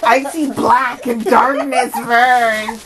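slow creepy witch voice. Acted. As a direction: drawl it like a spooky witch. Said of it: "makes me sound like a witch".

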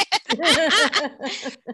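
high delighted laugh; loud burst of laughter. Real, not acted. Host laughs recalling the Dahmer TikTok. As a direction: crack up laughing.

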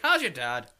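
loud mock Irish brogue; imitated Irishman. Co-host quoting the Irish guy, mock Irish accent. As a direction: ask it in a put-on Irish accent. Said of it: "He's like".

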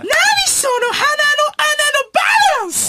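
shrieked, very high mock disbelief; 川北麻由子. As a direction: squeal each phrase at the top of the range. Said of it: "初めて会う川北麻由子さん". (甲高く叫ぶ川北のものまね)

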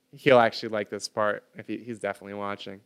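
usual speaking voice

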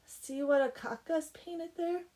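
a hint of a little child's high voice; baby voice. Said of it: "And the baby says".

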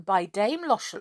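ordinary delivery, nothing marked

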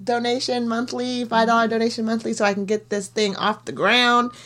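upbeat sign-off plug; podcast outro plug. Acted. Host asks listeners to follow and donate, as an upbeat outro. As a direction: sell the podcast warmly.

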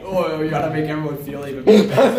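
loud mocking whine of a complainer; jeering, hammed-up self-pity; mock crybaby complaint. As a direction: imitate someone making a fuss. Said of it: "It's just you cry, you're just all like".

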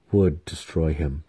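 plain reading voice

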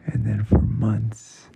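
ordinary delivery, nothing marked

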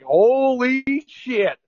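slow, drawn-out amazed gasp of words; retelling a jaw-dropping moment, slowly; stunned disbelief. Retold or imitated. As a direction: let the shock stretch each word. Stunned reaction in retold story.

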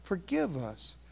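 soft, slow murmured plea; quiet plea to God; hushed prayer. Real, not acted. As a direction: pray it softly. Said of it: "Oh God".

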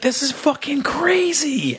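high, slow, drawn-out disbelief; stunned disbelief. Retold or imitated. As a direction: stretch it out in shock. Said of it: "And I was like".

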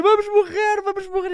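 put-on a kid wailing that they'll die; terrified child screaming. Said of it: "aos gritos a dizer". (criança aos gritos em pânico)

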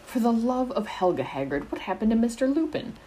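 Pomfrey demanding answers; demanding matron. A narrator's reading. Madame Pomfrey demands Hagrid explain the injured boy, alarmed. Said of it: "Madame Pomfrey demanded".

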